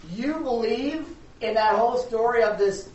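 loud, drawn-out mock disbelief; mocking lawyer. Agnostic lawyer to preaching girl, loud and mocking. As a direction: slowly taunt with exaggerated incredulity. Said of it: "he just started mocking her".

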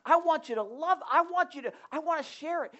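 loud, eager king bursting with news; excited convert. Acted. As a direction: blurt it out, can't hold back the joy. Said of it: "He's like".

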